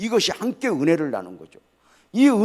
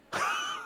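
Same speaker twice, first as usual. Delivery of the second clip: breathy chuckle; 7 dB quieter than usual. Real, not acted. Pastor laughs at his punchline.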